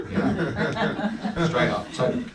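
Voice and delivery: ordinary delivery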